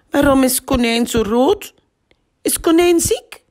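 put-on high, puzzled child's question; a little girl amazed her toy rabbit turned red; surprised. A narrator's reading. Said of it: "vraagt Anna verbaasd".

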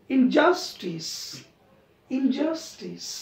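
Injustice slowed with JUS lifted; injustice stressed on JUS. Shown as a demonstration. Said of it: "just वरती आपण stress देतोय".

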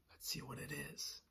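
hushed whisper. Real, not acted. Teacher whispering to the children before the reveal. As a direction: whisper to build suspense.

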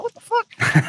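very high-pitched, incredulous yelp at normal volume; mock disbelief. Fighter learning his walkout music already started, comically incredulous. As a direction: replay being caught totally off guard.

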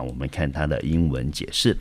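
ordinary talk

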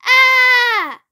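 high, drawn-out mild scream; acted scream of alarm; storybook scream. A narrator's reading. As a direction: cry out as a frightened boy in a story. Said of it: "screamed Radius".

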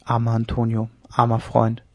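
resigned sigh. A narrator's reading. Lieutenant sighing over his dying captain, quietly sorrowful. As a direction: murmur it sadly over a dying friend. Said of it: "er seufzte und schüttelte den Kopf".

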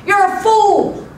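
high, loud acted taunt; aggravated yell. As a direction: yell it like an annoyed little boy. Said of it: "got aggravated and yelled".

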